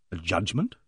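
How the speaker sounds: low, quiet questioning echo; staring disbelief. A narrator's reading. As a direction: repeat "judgment" low, with a questioning rise. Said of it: "I said, staring".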